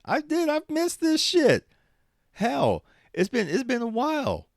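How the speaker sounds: very high, loud outburst; real whooping excitement at being back; excited exclamation. Real, not acted. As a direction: shout it out in a soaring pitch.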